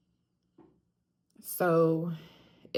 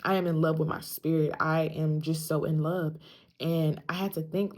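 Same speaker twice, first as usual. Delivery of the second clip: ordinary talk, nothing marked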